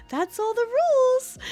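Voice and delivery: very high, drawn-out fairy voice; slow high-pitched fairy taunt; teasing fairy. Retold or imitated. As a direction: sing it out in a tiny fairy voice. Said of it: "they're, like, laughing and giggling".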